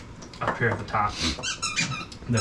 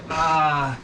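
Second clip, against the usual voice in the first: hesitant; 8 semitones higher, slower than usual. Acted. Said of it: "they look around, look at the, look at the drunk two guys".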